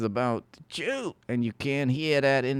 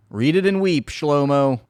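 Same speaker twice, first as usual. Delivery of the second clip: taunting; 3 semitones higher, 7 dB louder than usual. Real, not acted. Taunting Shlomo.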